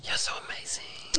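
hushed, breath-only praise; whispering to himself. Retold or imitated. Supporter silently praising someone in his head, whispered. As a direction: whisper it as a private cheer. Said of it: "Imagine me just whispering to myself".

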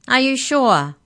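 high, loud booming question. A narrator's reading. Giant checks the unusual order with Mallory, booming. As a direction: boom it out to double-check. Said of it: "boomed Maury".

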